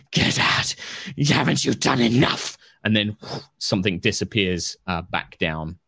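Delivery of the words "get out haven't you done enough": put-on frail breathless old person; hoarse wraith rasp, half whispered. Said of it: "this kind of rasping voice".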